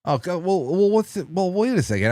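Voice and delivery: high, stammering interruption; alarmed objection. Real, not acted. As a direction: halt the caller before the awful ending. Host halts caller's grim story.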